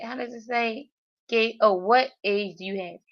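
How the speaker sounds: ordinary talk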